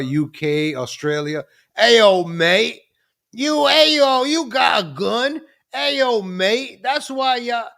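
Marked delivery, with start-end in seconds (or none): 1.8-7.7 high, loud, drawn-out mock Australian; badly faked Australian accent, pitched way up. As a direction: holler like a hammed-up Aussie.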